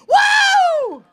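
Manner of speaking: high, loud cheering whoop; excited whoop. Real, not acted. Comic whoops to the crowd, a loud excited cheer.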